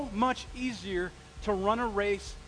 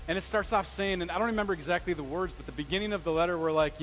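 normal talking voice

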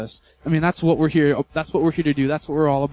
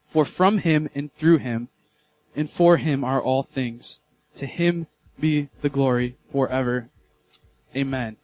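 scripture reading; 3 semitones lower than usual. Acted. Reads scripture slowly.

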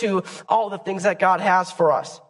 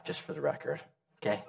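hushed aside; 6 semitones lower, 14 dB quieter, faster than usual. Real, not acted. Aside, don't want anyone dead.

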